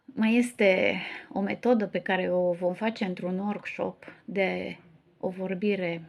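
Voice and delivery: ordinary talk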